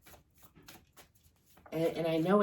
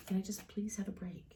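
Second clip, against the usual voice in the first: whispered, slightly higher plea; hushed plea; 4 semitones higher, 8 dB quieter than usual. Acted. Reader voicing a listener who just wants a break, hushed plea. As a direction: plead softly under your breath.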